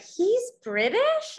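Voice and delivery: high-pitched, drawn-out mock disbelief; retold astonishment. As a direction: recount a stunning discovery, voice shooting up and slowing. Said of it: "my world was, like, totally shook".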